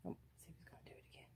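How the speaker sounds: fast whisper so as not to disturb him; whispering while watching the dog play. Real, not acted. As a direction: hush and whisper quickly.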